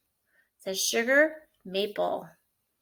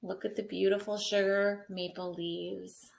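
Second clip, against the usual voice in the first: ordinary delivery, nothing marked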